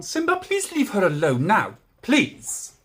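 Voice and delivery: loud, forceful telling-off; manly voice. Real, not acted. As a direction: shout a drawn-out plea at the dog. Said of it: "I did my manly voice then".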